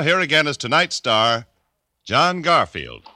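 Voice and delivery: usual speaking voice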